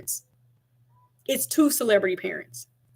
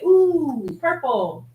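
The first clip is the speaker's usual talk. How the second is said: put-on prompting toddler; 6 semitones higher, much slower than usual.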